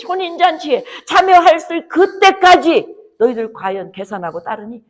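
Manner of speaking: high, loud and urgent pulpit cry; fiery preaching. Acted. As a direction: drive the challenge home to the congregation.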